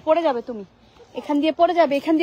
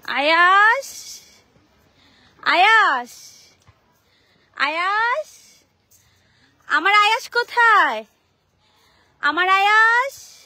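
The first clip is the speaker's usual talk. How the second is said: long drawn-out calls, louder and higher; calling out; 3 semitones higher, 6 dB louder, much slower than usual. Real, not acted. Mother searching for her son at the playground, slow drawn-out calls. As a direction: stretch the name out slowly to find him.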